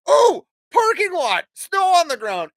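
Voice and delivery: high, loud, gleeful squeal; excited. Retold or imitated. Dad retelling spotting an empty snowy lot, gleeful. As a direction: spot a snowy lot with delight. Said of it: "I'm like".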